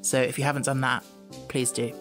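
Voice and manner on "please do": plain reading voice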